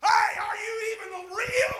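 high, slow, crying shout to heaven; desperate wail to God; anguished cry. Acted. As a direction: cry out in despair.

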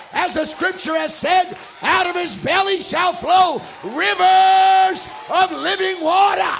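high, loud scripture proclamation; full-force preaching. Real, not acted. Preacher declaring God's promise to the church, full-force preaching. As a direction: thunder the promise, stretching key words.

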